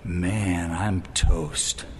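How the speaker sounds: low, soft, crumbling lament; despairing groan. Retold or imitated. Convicted sinner crying out to the Lord, low and broken. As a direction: fade into a hopeless sigh. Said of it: "should make you go".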